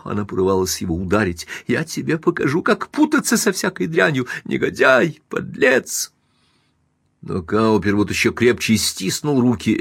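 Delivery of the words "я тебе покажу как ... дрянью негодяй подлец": high-pitched tirade climbing to a peak; furious shrieking. A narrator's reading. Wife lunges at cheating husband, furious shrieking. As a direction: hurl insults with rising pitch.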